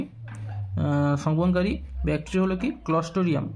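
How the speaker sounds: ordinary talk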